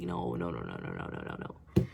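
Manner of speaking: low, rapid string of denials; low muttered imitation of pleading; mock panicked pleading. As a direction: drop the voice right down and keep repeating it. Voicing Blake begging her not to leave.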